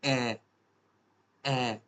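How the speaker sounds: ए given twice as separate long vowels; German A-umlaut sound. Shown as a demonstration. Teacher repeating the umlaut sound for learners, long ए. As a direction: hold each ए as German ä. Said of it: "इसकी क्या sound आएगी".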